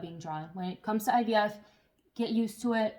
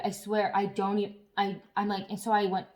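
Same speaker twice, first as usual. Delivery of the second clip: usual speaking voice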